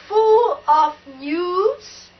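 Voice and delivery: normal talking voice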